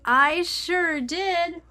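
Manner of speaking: high sing-song. Real, not acted. Vlogger owning up to her mistake, slow high sing-song. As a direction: stretch "I sure did" up high like a sheepish admission. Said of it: "said twice".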